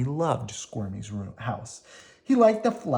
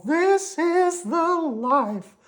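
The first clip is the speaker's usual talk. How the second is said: very high, slow worm voice; Slimy content; 4 dB louder, much slower than usual. Acted. Slimy relaxes with Squirmy, in a very high contented voice. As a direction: savour the peace.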